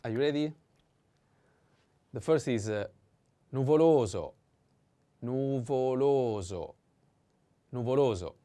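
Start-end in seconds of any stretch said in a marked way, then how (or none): 3.5-4.3 nuvoLOso, loud long LO; stress on second O. Shown as a demonstration. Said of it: "The accent is on the second O". 5.2-6.8 nuvoloso drawn out syllable by syllable; slow model. Shown as a demonstration. Teacher models word slowly.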